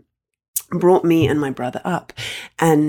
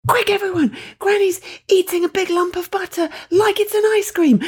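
high, gleeful summons to come and look; excited call to the family; 14 semitones higher than usual. Retold or imitated. Family member calling the others to laugh at granny, high and gleeful. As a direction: call everyone in, much higher and delighted. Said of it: "one person would say".

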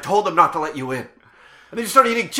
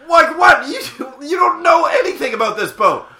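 indignant; 9 semitones higher, 8 dB louder than usual. Acted. Snapping at a clueless friend.

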